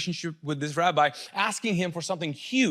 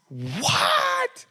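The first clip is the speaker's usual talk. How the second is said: very high, drawn-out "what?"; squeaky stretched-out "what"; shocked disbelief. Acted. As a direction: gasp it out in disbelief. Shocked reaction to Jesus' words.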